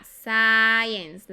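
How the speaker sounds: "science" loud and drawn out, I as "eye"; pronunciation demo. Shown as a demonstration. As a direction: stretch the "ai" vowel in "science", loudly. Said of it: "la I es I". ("science" fuerte y alargado, con la I como "ai")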